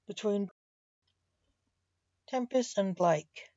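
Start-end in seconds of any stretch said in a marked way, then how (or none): none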